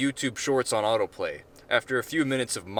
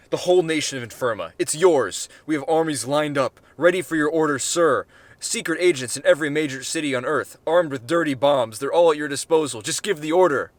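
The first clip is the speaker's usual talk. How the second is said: fervent loud plea; 4 semitones higher, 6 dB louder than usual. Acted. Ambassador pleads, offers armies.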